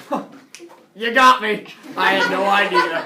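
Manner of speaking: loud, put-on voice of a god who was tricked; teacher voicing God being tricked by a fake fall; mock fooled deity. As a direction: play a sarcastic god pretending to be fooled. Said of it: "he's like".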